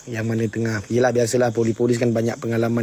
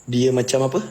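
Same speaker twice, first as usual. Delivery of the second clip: ordinary delivery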